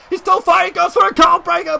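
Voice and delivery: high-pitched play-by-play burst; excited yell. Real, not acted. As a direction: voice leaps up for the fightback. Fightback in match.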